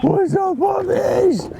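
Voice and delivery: very high shouted greeting; hyped shout. Real, not acted. Greeting the crowd after a trick, high hyped shout. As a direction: yell it out pumped.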